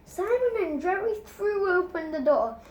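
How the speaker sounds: usual speaking voice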